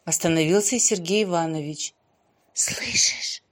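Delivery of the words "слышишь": hushed whispered question; soft, voiceless asking. A narrator's reading. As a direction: whisper it to someone close by. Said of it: "спросила шёпотом Маша".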